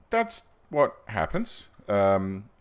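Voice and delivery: usual speaking voice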